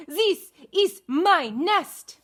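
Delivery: high, slow, loud duck voice; squabbling duck. Acted. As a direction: claim the nest like a bossy duck. Duck claims nest in story.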